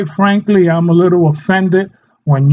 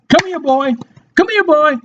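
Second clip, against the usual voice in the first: high-pitched pet-owner voice; mock a dog owner calling the pet; 8 semitones higher than usual. Shown as a demonstration. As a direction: pretend dog call, high and eager. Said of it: "You cannot say".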